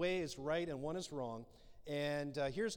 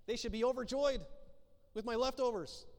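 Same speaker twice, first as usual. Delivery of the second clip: smug overjoyed; 6 semitones higher, 3 dB louder than usual. Retold or imitated.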